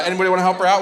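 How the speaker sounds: usual speaking voice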